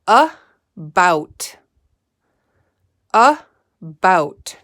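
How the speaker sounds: "About" split into "a" and "bout"; segmented syllables. Shown as a demonstration. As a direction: say "About" in two parts.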